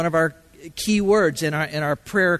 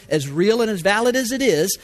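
ordinary talk

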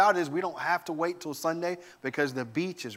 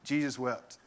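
sheepish mumble; 3 semitones lower, 7 dB quieter than usual. Retold or imitated. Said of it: "Mom was like ... Chris, what you got".

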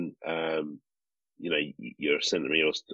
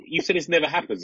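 higher, mock-indignant jab back at her; incredulous retort; 10 semitones higher than usual. Reminding the midwife of her promise, high mock indignation. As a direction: throw her words back at her, pitched up.

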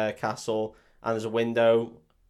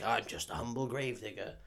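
soft, self-effacing gravedigger voice; humble gravedigger; 9 dB quieter than usual. Retold or imitated. Gravedigger introducing himself to Van Helsing, put-on humble voice. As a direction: play meek and innocent, much softer.

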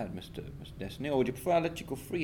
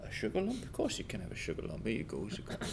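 soft, hushed coaxing; soothing a horse; 2 semitones lower, 7 dB quieter, faster than usual. Acted. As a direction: gently coax the horse. Soothing horse with sugar lump.

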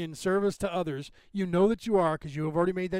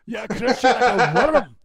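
louder, slower mock threat through a laugh; laughing mock villain; 4 semitones higher, 9 dB louder, slower than usual. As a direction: laugh while growling the villain line. Echoing villain line laughing.

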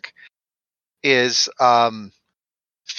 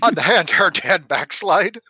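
melodrama hero; 7 semitones higher than usual. Pretend. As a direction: declaim like an old-time hero.

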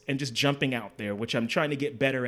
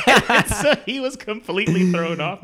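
real laugh spilling into louder words; 6 semitones higher, 9 dB louder than usual. Real, not acted. Telling friends how the architect was thrown off, laughing. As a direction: laugh through the punchline.

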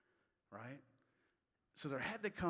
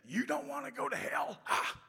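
high, loud imitation of a hellfire preacher; mock hellfire sermon shout; fire-and-brimstone preacher; 13 semitones higher, 7 dB louder than usual. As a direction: mimic the preacher with a punched "ha!" at the end. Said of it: "a fire and brimstone preacher".

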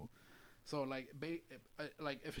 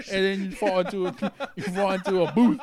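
higher, louder words pushed out through laughter; laughing; 8 semitones higher, 9 dB louder than usual. Real, not acted. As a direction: tell it while cracking up. Laughing while telling the scene.

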